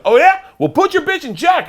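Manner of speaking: high, loud, belligerent shout; angry retort. Retold or imitated. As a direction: throw it back at him furiously. Driver's angry retort, quoted.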